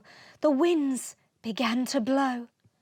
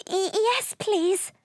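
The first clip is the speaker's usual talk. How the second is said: high, stammering little dragon, cold and scared; shivering, quivering reply; 8 semitones higher than usual. Acted. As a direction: stutter it out through chattering teeth. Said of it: "Smoky shivered, with a quiver in her speech".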